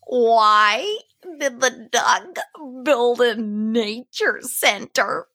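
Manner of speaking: put-on slow, loud, drawn-out kid's setup; little boy's voice. Retelling a five-year-old's joke to cohost, slow kid voice. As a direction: imitate a little boy dragging the words out. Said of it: "this is what he sounded like".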